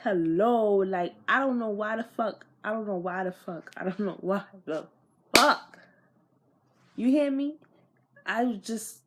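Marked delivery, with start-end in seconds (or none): none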